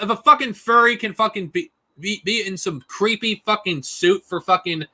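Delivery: ordinary talk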